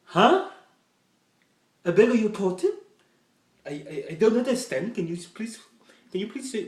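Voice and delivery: high, loud, confused waiter voice; baffled waiter. Retold or imitated. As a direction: lean in, straining to hear. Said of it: "And the waiter's like".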